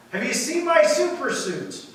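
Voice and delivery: lightly acted yell, calling out for something lost; mild mock yell. Superhero husband hunting for his suit, mock yelling. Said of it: "he starts yelling at his wife".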